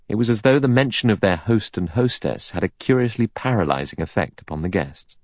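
plain reading voice

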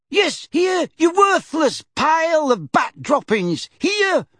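put-on old lady shrieking in fury. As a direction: scream it at the crook. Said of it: "shrieked Missus Figg".

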